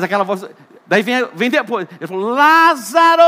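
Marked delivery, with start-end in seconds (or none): 2.3-3.2 high, loud summons of Lázaro; loud call. Acted. Jesus calling Lazarus again, loud. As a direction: shout "Lázaro!" commandingly.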